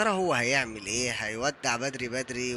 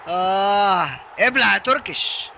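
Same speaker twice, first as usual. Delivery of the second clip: loud, higher, slow taunt; triumphant jeer; 4 semitones higher, 9 dB louder, much slower than usual. Real, not acted. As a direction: drag out the gloat, loud. Celebrating goal, jeering.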